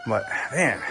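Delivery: ordinary talk, nothing marked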